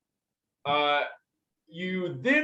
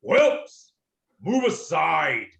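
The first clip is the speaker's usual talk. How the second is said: commanding dragon bellow; 5 dB louder, slower than usual. Acted. The great dragon orders smaller young dragons back, bellowing. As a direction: bark the first word, stretch the rest.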